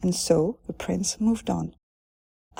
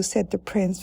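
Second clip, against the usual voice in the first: plain reading voice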